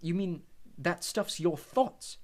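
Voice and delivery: a hint of an amazed boy; curious Harry. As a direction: ask in wonder at the basin. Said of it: "Harry said".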